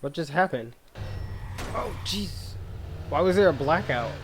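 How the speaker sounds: ordinary talk, nothing marked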